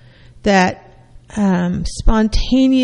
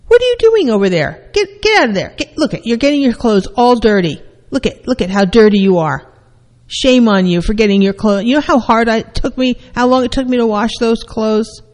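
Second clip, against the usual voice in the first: scolding mother; 2 semitones higher than usual. Retold or imitated. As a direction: shame the child for the dirty clothes. Parent scolding dirty child.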